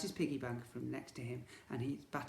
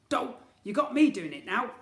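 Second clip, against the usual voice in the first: high, loud befuddled twin voice; muddled villain twin; 9 semitones higher, 12 dB louder than usual. Acted. Twins confusing each other's names, high loud muddled bickering.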